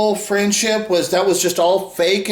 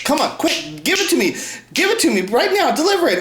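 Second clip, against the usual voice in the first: higher, pushy demand for it right now; impatient demand; 8 semitones higher than usual. Retold or imitated. Mocking impatient viewers who want quick videos, pushy. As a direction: hurry them up, mock impatient. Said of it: "they want quick".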